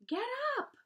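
very high, loud scream. A narrator's reading. Wilbur at the lounging rat, furious scream. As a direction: scream the order at full pitch. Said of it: "screamed Wilbur".

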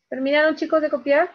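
calling out to class. Real, not acted. Teacher checks class finished copying.

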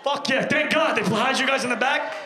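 ordinary talk

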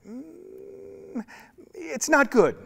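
high, drawn-out Mm then firm it's not good; a high, deliberate God voice; God's voice. Acted. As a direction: voice God weighing it up, disapproving.

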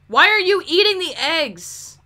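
loud, high outburst; exasperated scolding. Pretend. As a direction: yell at a misbehaving helper. Scolding game character eating stock.

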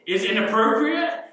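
high, loud leading question; mock scolding. Preacher grilling Haman over his behaviour, mock scolding. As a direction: demand the obvious answer.

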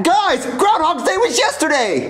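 high, drawn-out protest; exasperated high-pitched insistence; insistent disbelief. Acted. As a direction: plead with them to believe you. Boy insists day is repeating.

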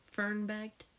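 usual speaking voice

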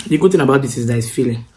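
eating between words; talking while chewing. Real, not acted. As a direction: mouth partly full. Seen 3: Pursed, working mouth between bites; lifts noodle forkful during words.

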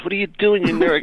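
high puppet squawk; a puppet snapping at its partner. Retold or imitated. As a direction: play the angry little puppet. Otto puppet voice.

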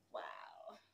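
breathless whispered wow; awed whisper. Real, not acted. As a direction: whisper it in pure awe. Awed reaction after the performance.

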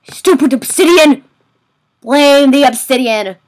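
loud, frustrated outburst; angry shout. Real, not acted. Gamer raging at the obsidian, angry and loud. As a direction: yell the blame at it.

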